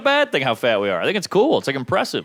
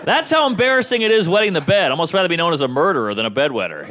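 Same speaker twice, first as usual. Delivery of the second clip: ordinary delivery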